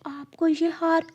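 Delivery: put-on high, soft child bird; a small son warning his mother gently; little chick voice. Chick warns mother not to trust crow, high and soft.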